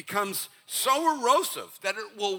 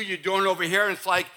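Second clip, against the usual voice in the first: normal talking voice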